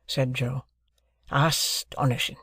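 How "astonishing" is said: lightly voiced blacksmith Joe, slow and kindly; a hint of a simple country smith. A narrator's reading. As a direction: ease into it like gentle old Joe. Joe voiced in read novel.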